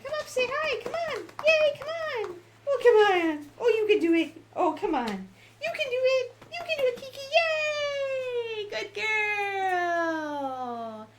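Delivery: very high, sing-song dog-coaxing voice; coaxing pet talk. Real, not acted. Owner coaxing her dog to come say hi, very high cooing.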